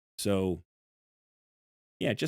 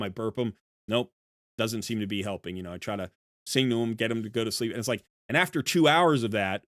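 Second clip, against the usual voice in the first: ordinary delivery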